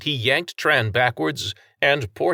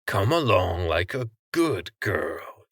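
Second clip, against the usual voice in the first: jeering. A narrator's reading. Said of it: "he jeered".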